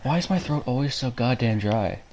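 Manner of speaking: ordinary talk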